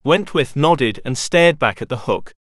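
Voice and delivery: usual speaking voice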